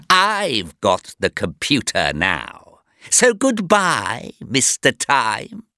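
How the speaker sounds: slow, drawn-out villain's farewell; gloating villain. A narrator's reading. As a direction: gloat slowly over the captives. Gloating villain bids captives farewell.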